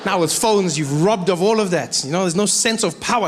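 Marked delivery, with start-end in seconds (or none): none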